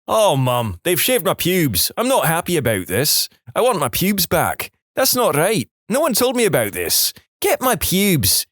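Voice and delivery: put-on high, furious rant in a broad Scottish accent; livid Scottish shout. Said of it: "I'm shouting in my broad Scottish accent".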